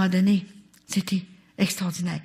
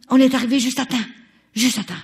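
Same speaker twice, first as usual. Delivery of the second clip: higher, louder insistence; emphatic excitement; 6 semitones higher, 6 dB louder, faster than usual. Real, not acted. Telling the crowd they arrived just in time, excited. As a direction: stress the timing with urgency.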